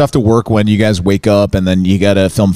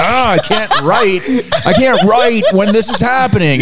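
much higher, slow, laughing whine; hammed-up distracted whimper; mock frustrated writer; 11 semitones higher, slower than usual. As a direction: whine through a laugh that you can't write. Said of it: "And then you're like".

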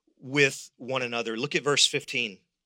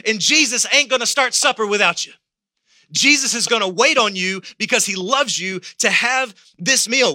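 louder, rising revival preaching; full-force preaching; 6 semitones higher, 6 dB louder than usual. Acted. Pastor stirring the church family, preaching at full force.

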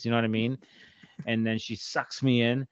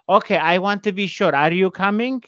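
higher, louder mother voice; mock a mom double-checking; imitating mom; 6 semitones higher, 5 dB louder than usual. Acting out mom on the phone.

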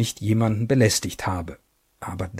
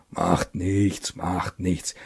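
lower, gruffly dismissive; a hint of an old general; 6 semitones lower, 5 dB quieter, much slower than usual. General brushes him off.

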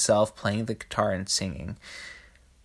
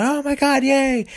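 high-pitched crowd cheer imitation; mock happy cheering; 13 semitones higher than usual. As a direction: cheer like an overjoyed townsperson, hammed up. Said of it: "being happy and going".

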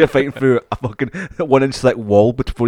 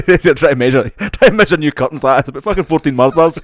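louder and higher, laughing as you joke; 6 semitones higher, 7 dB louder than usual. Real, not acted. Mate teasing mate about Mars bar units, laughing loud. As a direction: giggle through the silly measurement.

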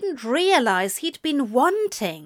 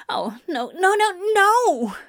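escalating acted dread; frantic refusal climbing sharply in pitch; rising panic; 8 semitones higher than usual. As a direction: start small, leap to a high panicked protest. Character panicking before being seen.

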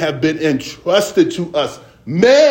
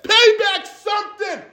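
high shouted exhortation; preaching shout; 13 semitones higher than usual. Real, not acted. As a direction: belt it out to the congregation.

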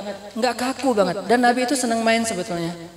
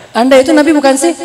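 louder, higher mock-indignant question; jealous, angry challenge; 4 semitones higher, 10 dB louder than usual. Wife challenging her husband the Prophet, jealous and angry. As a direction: voice a jealous wife's loud challenge, rising at the end. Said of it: "Aisyah cemburu dan marah".

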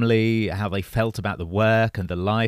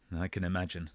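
mumbled; 3 semitones lower, 13 dB quieter, faster than usual. A narrator's reading. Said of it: "Evan looked away and mumbled".

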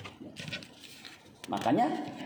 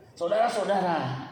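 imitated plodding preacher. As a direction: mimic a long-winded pastor opening up. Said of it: "ngomongnya pelan".

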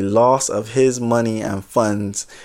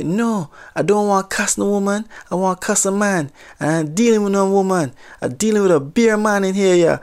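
higher-pitched Jamaican patois, worked up; retelling a hot-headed Jamaican customer at the counter, in Jamaican accent; 8 semitones higher than usual. Retold or imitated. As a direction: imitate an angry Jamaican customer in a light Jamaican accent. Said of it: "maybe I'll just try the accent just a little bit".

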